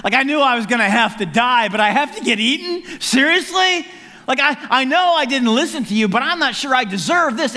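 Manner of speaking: high, loud, exasperated complaint; incredulous protest. Acted. Jonah to God about being eaten, incredulous protest. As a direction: protest in hammed-up disbelief. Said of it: "you've gotta be thinking".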